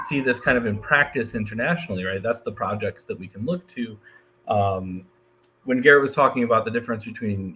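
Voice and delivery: ordinary talk, nothing marked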